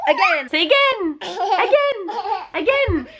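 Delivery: high, laughing demands for a repeat; high-pitched playful child imitation; imitated toddler pleading. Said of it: "he'll be like".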